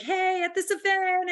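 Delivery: high-pitched, over-cheery announcement; mock-perky posting voice. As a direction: chirp it like a gushing social post. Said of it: "so fun and how I post".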